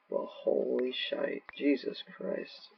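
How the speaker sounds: slow breathless whisper; slow awed whisper; stunned whisper. Real, not acted. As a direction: whisper it in disbelief. Aftermath of chaos, stunned.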